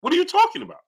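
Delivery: very high-pitched baffled questioning; squeaky-high indignant protest; incredulous. Real, not acted. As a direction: push the voice way up in disbelief. Disbelief at the accusation.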